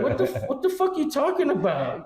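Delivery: high, laughing outrage; half-laughing, half-outraged question; Father laughing in disbelief at his son's Uber Eats bill. Retold or imitated. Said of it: "I'm like".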